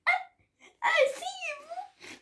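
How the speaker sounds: very high, slow, sobbing elf moan; high wailing sob. A narrator's reading. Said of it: "gémit la créature".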